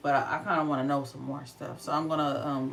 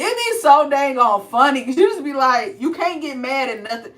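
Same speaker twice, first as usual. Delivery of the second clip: excited; 9 semitones higher, 12 dB louder than usual. Real, not acted. Excited about kids' personality.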